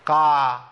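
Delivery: قاع said with the mouth fully open; wrong fully open قاع; wrong open qaf. Shown as a demonstration. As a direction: show the mistake: قاع with full opening. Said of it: "ما أقولش".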